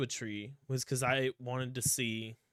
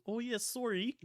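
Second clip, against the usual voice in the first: high, softer Midwestern apology; Midwestern accent; 8 semitones higher, 7 dB quieter than usual. Pretend. Voicing a toy come to life, soft Midwestern accent. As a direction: lay on a playful Midwest accent, quietly. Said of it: "I want that Midwestern accent".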